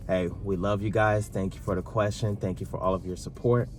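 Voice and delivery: ordinary delivery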